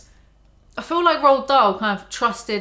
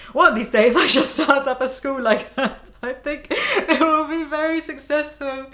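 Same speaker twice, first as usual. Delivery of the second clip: lebih tinggi, terselip tawa; tertawa sambil membaca; 6 semitones higher than usual. Real, not acted. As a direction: tahan geli sambil membaca. Laughs reading Trunchbull line.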